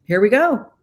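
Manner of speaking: loud, high, cheery we're-fine voice; mock hearty guy shrugging it all off; breezy male brush-off. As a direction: toss it off like nothing happened. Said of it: "from a male perspective".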